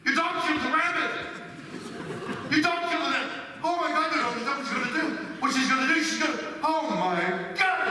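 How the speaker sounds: spiral into panic; panicked friend. Retold or imitated. Friend Bob fearing the neighbor, frantic and high. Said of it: "My friend Bob says".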